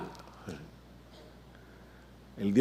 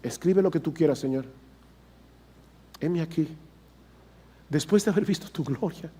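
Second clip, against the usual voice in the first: soft, hushed prayer; softly spoken, reverent plea; humble surrender; 3 semitones higher, 7 dB quieter than usual. Acted. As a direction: offer yourself quietly to God, with long pauses. Voices Isaiah surrendering to God.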